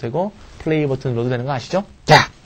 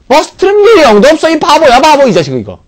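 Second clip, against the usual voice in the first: mock scolding; 12 semitones higher, 9 dB louder than usual. Teacher mock scolds the must.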